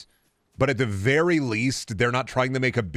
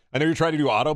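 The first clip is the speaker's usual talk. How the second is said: ordinary talk, nothing marked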